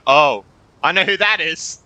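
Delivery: normal talking voice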